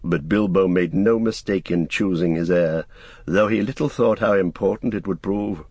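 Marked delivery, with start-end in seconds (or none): none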